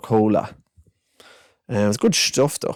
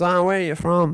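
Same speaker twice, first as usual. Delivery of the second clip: put-on higher, cheeky young Dublin kid voice; 8 semitones higher than usual. Narrator quotes the cheeky Dublin boy, higher and chirpy. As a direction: play a chatty seven-year-old. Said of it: "he goes".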